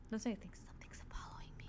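quick hushed confession; real uneasy whisper; fearful whisper. Real, not acted. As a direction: whisper it as if overheard. Confiding fear, whispered.

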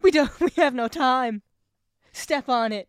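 high, loud hammed-up panic; over-dramatic action-film urgency; mock urgency. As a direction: shout orders in mock haste. Parodies urgent film dialogue.

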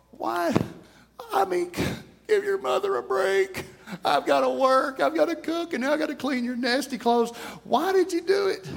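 high-pitched, whiny mock pleading; exasperated mother. Mother to muddy son about dirty clothes, exasperated. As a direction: play a worn-out mom wailing her complaint.

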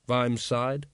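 ordinary delivery

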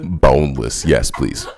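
loud deadpan order. Pretend. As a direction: shout it flatly. Caller shouts boneless again.

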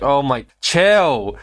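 half-yell it like an overexcited fan; imitated excited scream. Imitating a fan meeting a content creator, mock screaming. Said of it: "they just start screaming their name".

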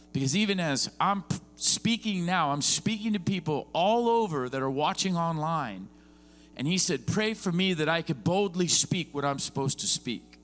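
high, loud, driving sermon delivery; fired-up pulpit preaching; preaching at full force. Real, not acted. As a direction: preach it hard to the room.

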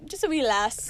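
playful Scottish lilt; mock Scottish accent. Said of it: "Are you Scottish now?".

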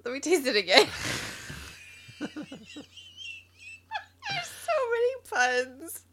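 laughing. Real, not acted. Can't stop laughing.

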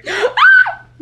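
sharp loud high gasp with a laugh; thrilled shrieking gasp; excited gasp. Real, not acted. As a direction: gasp in delight. Excited recognition of a crush.

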